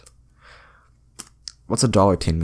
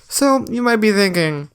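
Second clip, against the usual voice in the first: high mock voice; 12 semitones higher than usual. Mock listener voice.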